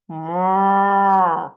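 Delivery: loud, long moo; cow mooing. Acted. Teacher making animal sounds for children, mooing. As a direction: bellow a drawn-out moo. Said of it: "help me with the animal sounds".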